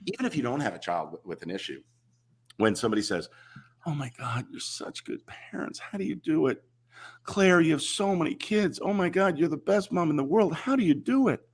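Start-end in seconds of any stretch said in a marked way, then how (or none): 3.9-11.4 breathy then high, syrupy over-praise; lightly hammed-up fawning admirer; mock gushing. Parody of people praising parents, mildly syrupy and high.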